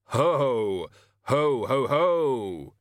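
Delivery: deliberate, booming forced ho-hos; practised Santa-style laugh. Pretend. Death answering with the Hogfather laugh, stilted. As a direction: boom out laughter without mirth.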